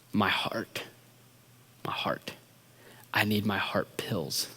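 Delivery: barely audible, whispered plea; weak mumbling. Retold or imitated. Stricken friend asking for pills, faint whispered mumble. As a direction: gasp it faintly, as if collapsing. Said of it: "he's quickly mumbling as best as he can".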